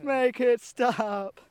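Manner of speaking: long, drawn-out high shout; mock tortured wail. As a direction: wail it slowly like a comic torture victim. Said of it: "they're torturing him".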